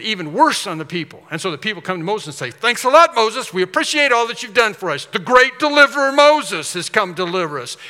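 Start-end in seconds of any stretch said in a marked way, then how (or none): 2.6-7.8 loud, high mock gratitude; acted-out bitter crowd complaint; sarcastic complaint. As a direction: heap sarcastic praise on him, loudly. People sarcastically blaming Moses.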